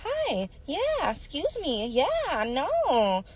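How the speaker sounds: high, sweet customer-service voice; code-switched polite voice. Retold or imitated. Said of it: "All that".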